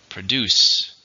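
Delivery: "Produce" with a loud second syllable, DUCE; "Produce" said loudly, stressed on the end. Shown as a demonstration. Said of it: "with stress on the second syllable".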